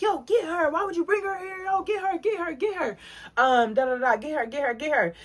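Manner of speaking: high, fast "get her!" egging on; hyped-up friend yelling to grab her; frantic urging. Retold or imitated. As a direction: urge him on in a frantic high voice. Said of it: "was like".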